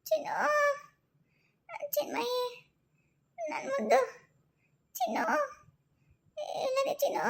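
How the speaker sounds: soft, high, broken sobbing calls. Acted. Waking mother calling her little daughter, softly sobbing. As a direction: weep out her child's name, barely above a breath.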